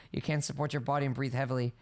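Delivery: ordinary talk, nothing marked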